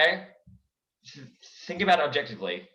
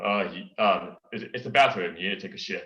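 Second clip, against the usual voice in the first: Kronk voice; 6 semitones lower than usual. Acted. Kronk replies to the king.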